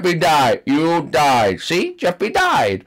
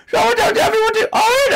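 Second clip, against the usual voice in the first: high fast plea; faster than usual. Acted. Confused character pleads.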